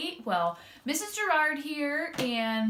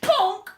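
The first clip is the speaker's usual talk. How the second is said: louder comic thud word; ponk sound effect; 6 semitones higher, 7 dB louder than usual. A narrator's reading. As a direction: pop it out. Norman lands.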